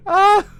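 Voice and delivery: very loud, high-pitched cry; piercing outburst; high shriek. Real, not acted. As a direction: shriek in reaction. Outburst at the manga.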